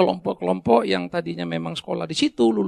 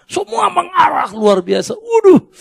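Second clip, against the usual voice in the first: excited shout; 10 semitones higher, 9 dB louder, slower than usual. Real, not acted. Preacher exclaims excitedly.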